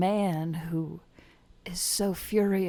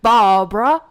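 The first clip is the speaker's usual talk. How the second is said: angry British man; 10 dB louder than usual. Pretend. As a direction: rant in a British accent about breakfast. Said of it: "I'm a British man who's angry about breakfast".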